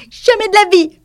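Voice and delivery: shrieking high, loud mouse; squeaky furious refusal; indignant cry. A narrator's reading. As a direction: cry out in outrage, voice way up. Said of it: "s'écria la souris".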